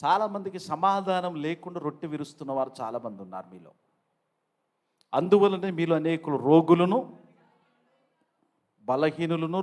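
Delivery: ordinary delivery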